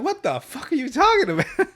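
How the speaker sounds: high, baffled outburst; incredulous. Retold or imitated. Listener reacting to the nonsense lesson, high and baffled. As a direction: say it in disbelief. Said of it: "I'm like".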